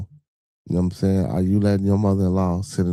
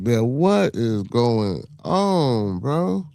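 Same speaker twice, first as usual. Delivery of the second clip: slow, drawn-out exasperation, pitched up; exasperated rant; 5 semitones higher, 5 dB louder than usual. Real, not acted. Streamer ranting at the losing Lakers, exasperated. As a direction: rant in disbelief, stretching the words out.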